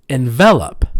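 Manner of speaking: enVELop, loud on VEL; second-syllable stress. Shown as a demonstration. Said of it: "say the second syllable".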